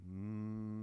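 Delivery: steady bee-like buzzing hum. Shown as a demonstration. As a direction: hold a long even buzz through the lips. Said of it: "Make the buzzing sound".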